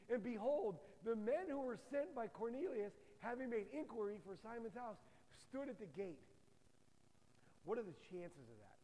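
ordinary delivery

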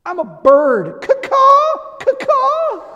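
very high, loud squawking caws; child being a bird. Retold or imitated. Daughter leans over sleeping dad, imitated loud high bird squawk. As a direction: imitate a kid cawing like a bird. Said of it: "she leans over me and she goes".

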